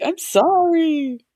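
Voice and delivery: high, stretched-out playful sorry; hammed-up plaintive apology; mock apology. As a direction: retell a helpless apology to your kid. Retold apology to complaining son.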